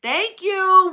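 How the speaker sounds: ordinary talk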